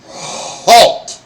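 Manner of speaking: loud barked command to stop; shouted halt. Acted. Goblin guard stops the travellers with a loud bark.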